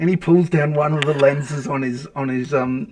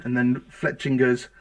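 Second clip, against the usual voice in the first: normal talking voice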